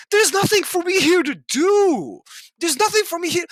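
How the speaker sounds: high, despairing outburst of frustration; exasperated. Real, not acted. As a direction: wail out your frustration, pitched well up. Despairing rant about life here.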